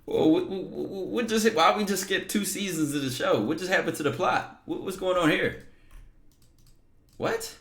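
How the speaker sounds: high, stammering disbelief; flabbergasted. Real, not acted. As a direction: sputter questions at a high pitch, totally lost. Stunned by the husband twist.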